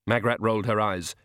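usual speaking voice

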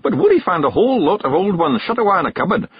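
a wee Scottish chief giving orders; Scottish pixie. A narrator's reading. Rob orders the boys to make tea, Scottish bark.

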